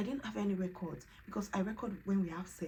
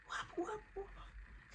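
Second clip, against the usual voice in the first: high surprised question; 14 semitones higher, 8 dB quieter than usual. Retold or imitated. Husband alarmed question.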